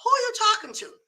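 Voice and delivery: acted high-pitched challenge; mock loud challenge. Overheard talker flaring up mid-conversation, mock indignant. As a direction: pitch it way up, like someone confronting you. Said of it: "you hear it get loud".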